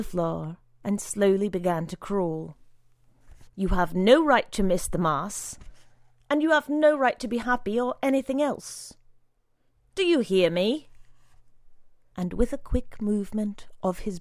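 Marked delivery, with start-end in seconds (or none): 3.5-10.9 angry condemnation. A narrator's reading. Brother condemns boy.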